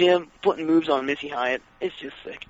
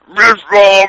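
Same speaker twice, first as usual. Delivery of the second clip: louder put-on giant voice; giant's promo line; 3 semitones higher, 10 dB louder than usual. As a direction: boom it out like a clueless giant. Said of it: "Elegante puts his hand up in the air".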